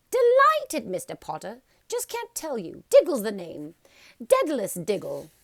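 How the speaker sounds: high, loud, bubbling little man; excited little man. A narrator's reading. Daedalus Diggle introduces himself to Harry, high and loud. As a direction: bounce with giddy delight.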